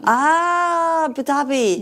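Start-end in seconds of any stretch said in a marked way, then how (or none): 1.0-1.8 higher, careful try at "Abu Dhabi" without the first A; sounding out "Dhabi" with a hard D; trying the local Abu Dhabi. Shown as a demonstration. Host attempts the local Arabic pronunciation, carefully. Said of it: "Say it again?".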